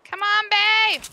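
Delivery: high, loud urging yell; calling out. Real, not acted. Player urging partner to come, calling out. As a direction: shout to hurry your partner along.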